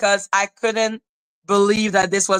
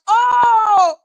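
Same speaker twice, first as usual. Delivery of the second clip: higher, louder startled cry; a jolt of shock as she picks up; startled shout; 10 semitones higher, 5 dB louder than usual. Retold or imitated. Retold her answering.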